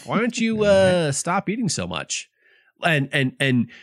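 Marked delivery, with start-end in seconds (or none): 0.0-2.3 much higher, put-on voice of a nagging husband; mock a husband scolding his wife's weight. Cohost voicing a French husband nagging his wife, higher put-on voice. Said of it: "husbands are like".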